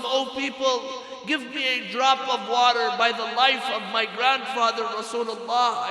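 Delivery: high, loud preacher's appeal; retold desperate plea. Dying Imam begging the people for water, retold as a loud plea.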